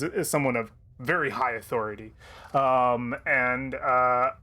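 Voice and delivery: normal talking voice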